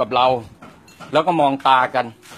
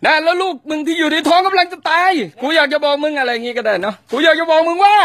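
higher, pushed angry reading; demo angry line; 10 semitones higher than usual. Shown as a demonstration. Director demonstrates the threat to the actor, higher and angrier. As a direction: spit the threat at him. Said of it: "พูดแค่นั้นก็ได้".

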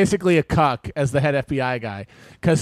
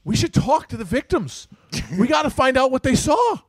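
raised, emphatic local-hero voice; piping up in character; 6 semitones higher than usual. Acted. Wahlberg's character piping up among FBI brass, hammed-up insistence. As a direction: pipe up over a crowd, hammed-up.

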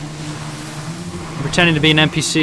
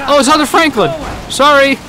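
higher startled oh it's him; surprised realisation; 10 semitones higher than usual. Real, not acted. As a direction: catch yourself with a startled laugh-ready apology. Recognising teammate after startling.

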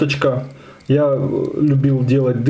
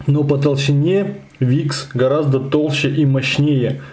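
ordinary talk

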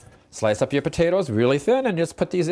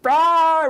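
dog's happy yelp; 14 semitones higher, 6 dB louder than usual. Retold or imitated. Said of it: "and she go".

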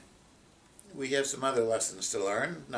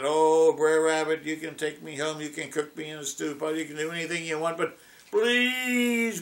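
higher, mock-desperate begging; pleading rabbit; 4 semitones higher, 4 dB louder than usual. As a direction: overplay the terror of the briars. Rabbit begs not to be thrown.

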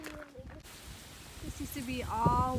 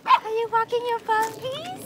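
higher, louder sing-song question; 8 semitones higher, 6 dB louder than usual. Real, not acted.

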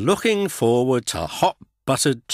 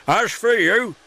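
much higher, louder thunder; 13 semitones higher, 5 dB louder than usual. A narrator's reading. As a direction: turn on the big engines. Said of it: "he thundered".

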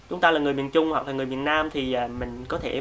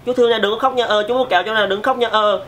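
loud, higher, blunt coaxing; everyday voice, too strong; 6 semitones higher, 5 dB louder than usual. Shown as a demonstration. Adult scares a baby with an everyday voice, loud and unsoftened. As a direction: talk to the baby far too forcefully. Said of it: "nói bình thường các bạn sẽ nói như thế này".

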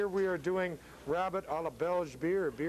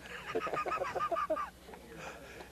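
very high, wheezy-light laughter; real laugh after a throwaway joke; amused laugh; 4 dB quieter than usual. Real, not acted. Laughs after ruining-pan joke.

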